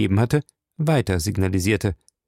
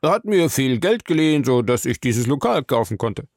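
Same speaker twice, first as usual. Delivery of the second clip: glib suspect; 3 semitones higher than usual. Acted. Bar owner explaining his debt to the captain, glibly. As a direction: play the smooth-talking bar owner, pitched up.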